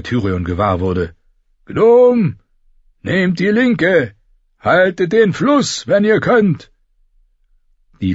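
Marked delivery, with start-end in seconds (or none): 1.7-6.7 slow, high contemptuous bellow; roaring. A narrator's reading. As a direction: bark orders at the dwarf with scorn. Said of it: "brüllte er". (verächtlich brüllend, langsam)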